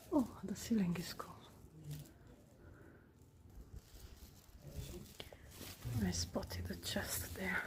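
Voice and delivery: hushed church whisper. Real, not acted. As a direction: whisper so as not to disturb. Whispering inside a quiet church.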